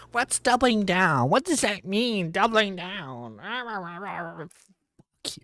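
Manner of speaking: high, whiny mock of someone clueless; high-pitched mocking, dragging out the last words; mocking echo. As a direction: parrot it back in a high silly voice. Mockingly echoing the phrase doubling down.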